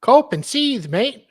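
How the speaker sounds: very high, loud fake Aussie; squeaky-high attempt at Australian; Australian accent. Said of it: "That's not an Australian accent".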